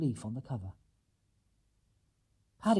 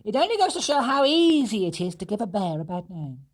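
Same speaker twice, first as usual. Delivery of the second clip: a raised, earnest storybook voice; raised character voice; 7 semitones higher, 5 dB louder than usual. Acted. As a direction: speak up for the bear's honour.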